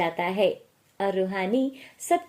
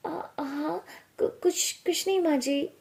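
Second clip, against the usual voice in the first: higher, hesitant and startled; flustered stammer; 6 semitones higher, 3 dB quieter than usual. Acted. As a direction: caught daydreaming, soft stumbling start. Startled out of a daydream by mother-in-law.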